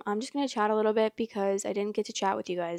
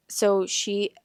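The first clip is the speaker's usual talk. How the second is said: usual speaking voice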